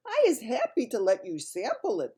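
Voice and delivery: high, eager BFG voice; friendly giant. A narrator's reading. BFG praises the snozcumber to mislead Bloodbottler, high and eager. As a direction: sell the vegetable with bright enthusiasm. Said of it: "the B F G went on".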